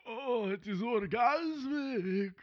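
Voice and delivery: high, slow ghostly vampire. Pretend. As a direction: savor it like an undead count. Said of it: "you got real ghostly there".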